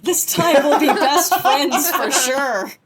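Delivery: laughing mock Harry. Voicing naive Harry.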